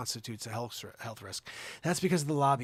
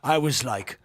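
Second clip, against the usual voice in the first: put-on Jeremy Irons English accent; 4 dB louder than usual. Host reads celebrity scandal in put-on English accent. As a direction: read it as a grand English actor. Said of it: "My English accent is essentially Jeremy Irons".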